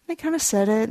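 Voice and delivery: usual speaking voice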